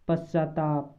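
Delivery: ordinary talk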